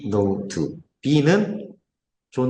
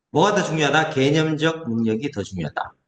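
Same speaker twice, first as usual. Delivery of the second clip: ordinary delivery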